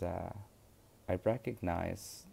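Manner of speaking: normal talking voice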